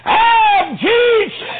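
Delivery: very high, loud, slow ringing cry; preached proclamation. Acted. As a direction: proclaim it to the whole church. Preacher exalting Jesus.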